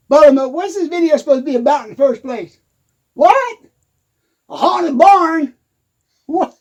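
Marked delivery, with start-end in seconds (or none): 3.1-6.5 high-pitched, drawn-out disbelief; incredulous. Acted. Answering Buttermilk's haunted barn claim, incredulous. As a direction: question it as if hearing nonsense.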